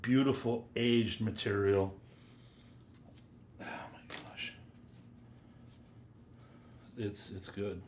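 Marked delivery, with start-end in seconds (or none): 3.6-4.5 hushed, voiceless awe; real breath-only murmur of amazement; awed whisper. Real, not acted. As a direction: whisper it, overwhelmed by the scent. Overwhelmed on smelling the scent.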